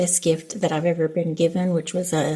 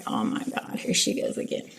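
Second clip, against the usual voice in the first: put-on whispered, eye-rolling groan from the kid; exasperated son; 8 dB quieter than usual. Mom imitating her son, whispered and exasperated. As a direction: breathe it out like a fed-up ten-year-old. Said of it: "he looks at me like".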